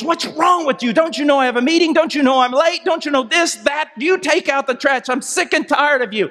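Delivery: high, exasperated rant at a spouse; acted fit of rage. Late husband berating his wife over the trash, acted rage. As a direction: play a husband blowing up, pitched high. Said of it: "in a fit of rage".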